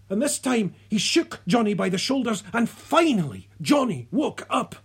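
ordinary delivery

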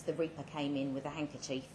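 plain reading voice

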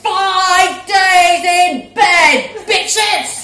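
loud, very slow, half sung; gleeful loud crowing; sing-song shout. Acted. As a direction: crow it to the sky. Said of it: "she called".

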